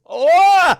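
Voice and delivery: sudden high, loud "oh!"; jolted awake by a dog in the next seat; startled shriek. Retold or imitated. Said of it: "I was like".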